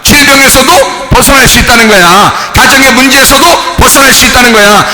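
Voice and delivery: usual speaking voice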